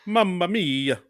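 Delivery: sing-song cartoon Italian accent; exaggerated Mario accent. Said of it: "if you do an exaggerated Mario accent".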